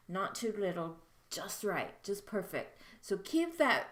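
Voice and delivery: ordinary delivery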